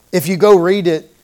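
ordinary delivery

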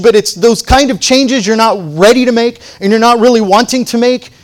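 high, loud sermon delivery; impassioned preaching. Real, not acted. Preacher warns congregation about resisting God's change, impassioned preaching. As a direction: press it home at full force.

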